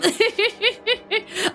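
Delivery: high giggle; high laugh. Real, not acted. Streamer laughing at admitting she judges, high giggle.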